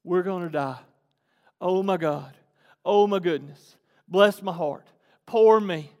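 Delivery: self-pitying grumble, broken into short laments; lightly acted woe-is-me complaint; mock complaining. Preacher voicing the Israelites stuck at the sea, mock complaining. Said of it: "are sitting here complaining".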